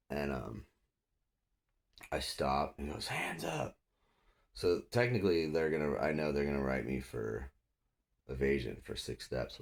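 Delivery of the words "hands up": high mock yell, kept soft; imitating officer's command. Cop ordering suspect to raise hands, high mimicked yell. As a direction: imitate a cop yelling from a distance.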